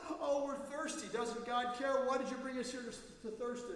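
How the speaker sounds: high, loud complaining cry; whining Israelites. Acted. Preacher voices the hungry people, high and loud. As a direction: whine it like a desperate crowd.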